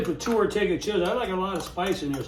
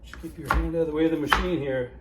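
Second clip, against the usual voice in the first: ordinary talk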